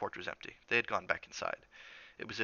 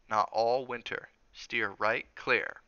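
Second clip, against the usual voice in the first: slow, spaced-out warning; firm warning; 2 semitones higher, 4 dB louder, much slower than usual. Acted. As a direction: draw each word out to make it stick. Cook warns boy off a room.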